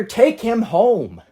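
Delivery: ordinary talk, nothing marked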